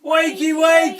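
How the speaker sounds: high, loud sing-out; group call-out yell. Shown as a demonstration. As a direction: shout the wake-up call to lead everyone. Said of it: "we all say".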